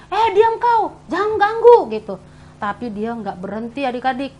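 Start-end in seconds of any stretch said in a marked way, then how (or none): 0.1-1.9 crowd scolding. Acted. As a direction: tell off a beggar harshly.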